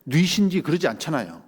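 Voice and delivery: ordinary talk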